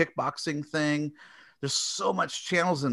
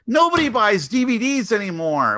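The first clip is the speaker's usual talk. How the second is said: exasperated; 10 semitones higher, 4 dB louder than usual. Real, not acted. Ranting about DVD sales.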